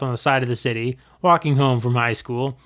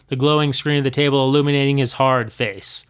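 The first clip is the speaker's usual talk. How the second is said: plain reading voice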